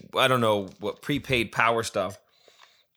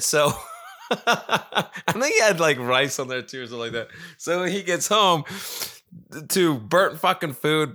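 chuckling through the words, higher; laughing; 4 semitones higher than usual. Real, not acted. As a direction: let the laugh spill into the line. Laughing while retelling.

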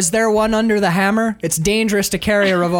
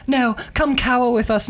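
higher-voiced mock matron; a put-on, higher call to huddle; Nicole Kidman; 5 semitones higher than usual. Acting the woman gathering the others, higher pitched. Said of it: "Nicole Kidman's like".

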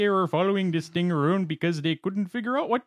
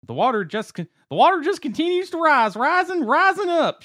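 mock homeowner quote; 12 semitones higher, 4 dB louder than usual. Quoting flooded homeowner, high.

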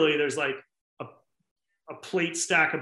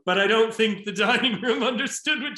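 laughing tease; 6 semitones higher, 4 dB louder than usual. Retold or imitated. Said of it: "he goes".

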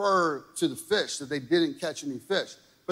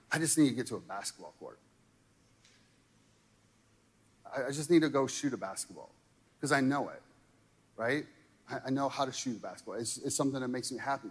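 rushed inner thought; 4 semitones lower, 7 dB quieter, much faster than usual. Retold or imitated. Preacher retells own stressed thought.

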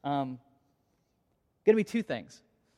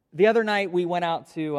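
laughing; 3 semitones higher, 8 dB louder than usual. Real, not acted. Laughing while recalling tearful goodbye.

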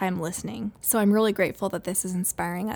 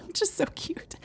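whispered aside; 9 semitones higher, 7 dB quieter than usual. Real, not acted. Aside after kids' quote.

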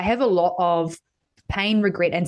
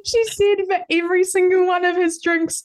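thrilled, almost squealing as she tells it; giddy delight in the revenge; excited; 11 semitones higher than usual. Real, not acted. Laughing disbelief retelling.

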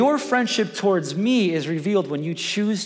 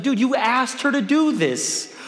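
exasperated dad; 8 semitones higher than usual. Retold or imitated. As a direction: plead with the kids, half laughing. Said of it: "And I'm just like".

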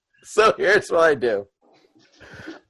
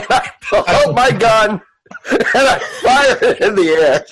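high, laughing narration; cracking up while describing the action; 5 semitones higher than usual. Real, not acted. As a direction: tell it through helpless giggles. Laughing at own absurd move.

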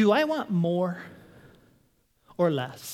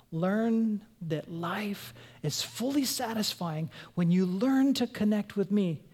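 ordinary delivery

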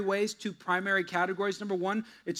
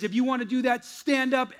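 preacher's call; 6 semitones higher, 3 dB louder than usual. Retold or imitated. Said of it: "And he says".

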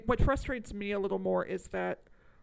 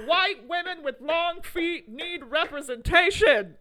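loud, high, drawn-out mock protest; mock outcry; 8 semitones higher, 8 dB louder, slower than usual. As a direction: belt it out slowly like a hammed-up slogan. Mock protest slogan, acted quote.

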